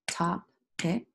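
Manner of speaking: "Topic" as two separate beats, top, ic; broken into syllables. Shown as a demonstration. Teacher breaking the word apart, "Topic" in separated syllables. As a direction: pause between the halves of "Topic". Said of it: "Let's break it apart".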